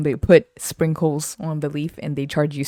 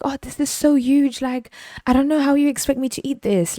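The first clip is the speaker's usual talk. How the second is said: imitation complainer, pitched up; higher put-on voice of a fussy customer; whining customer; 8 semitones higher than usual. Customer objects to huge candy, mock complaining.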